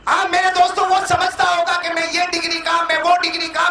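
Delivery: high, driving list, item after item; full-force preaching. Acted. Preaches against pride in degrees. (जोशीली, ऊँची आवाज़ में तक़रीर)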